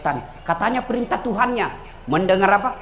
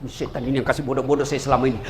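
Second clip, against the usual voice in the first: grumbling pilgrim; 10 semitones lower, 3 dB quieter, faster than usual. Acted. Pilgrim grumbling before throwing.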